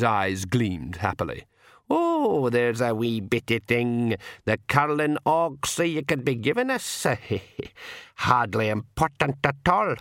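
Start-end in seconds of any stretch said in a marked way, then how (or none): 1.9-10.0 slow Scots-like pixie dialect, with a chuckle; wheedling old pixie. A narrator's reading. Old pixie asks the king a wee favour, wheedling dialect. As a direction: wheedle slowly, making light of it.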